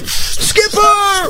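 very high, drawn-out cry; desperate calling out. Acted. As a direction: call across the water in mock despair. Cries as captain is pulled under.

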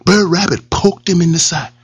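ordinary delivery

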